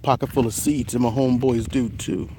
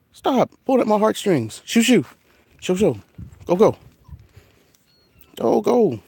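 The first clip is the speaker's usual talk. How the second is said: shooing the cat; 8 semitones higher than usual. Real, not acted.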